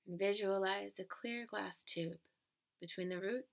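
calm, even relaxation-script delivery; guided meditation. Acted. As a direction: guide the listener gently into stillness.

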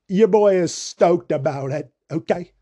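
slow, lightly hammed-up hype voice; a hint of a goofy put-on voice; odd put-on voice. Real, not acted. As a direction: drawl it out like a pumped-up bro, only a touch. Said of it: "Don't know why I'm talking like this right now".

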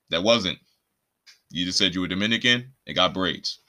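ordinary talk

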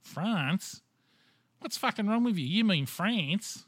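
outraged mock yell, high and loud, slow at the end; mocking friends. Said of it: "And they go".

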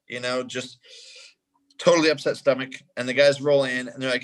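ordinary delivery

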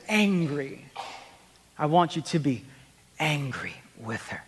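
hushed, low, deliberate counsel; therapist's advice. Retold or imitated. Therapist instructing a husband, hushed and deliberate. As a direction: drop low and soft, pausing before the key words.

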